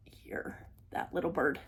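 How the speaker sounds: soft whisper while pointing out. Real, not acted. Showing viewers the little bird in a whisper. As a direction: hush down to a whisper.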